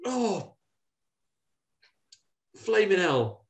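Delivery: high yelp of a dying demon. Retold or imitated. Demon yelps after she stabs herself. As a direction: squeal it as the blade goes in. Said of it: "And he goes".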